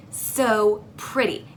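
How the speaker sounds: ordinary talk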